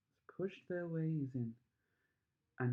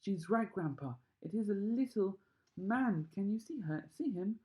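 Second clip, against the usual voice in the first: excited; 6 semitones higher than usual. A narrator's reading. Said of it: "said Grandpa Joe excitedly".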